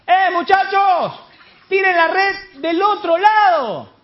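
high, drawn-out holler; long, loud shouted instruction from the shore; shouting from afar. Retold or imitated. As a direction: call slowly across the water to fishermen. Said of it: "¿Quién es que grita allá?".

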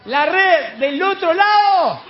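high, loud, drawn-out holler; stretched-out shout from afar; insistent shout. Retold or imitated. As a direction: keep calling across the water, slowly. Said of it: "Y sigue el Señor insistiendo".

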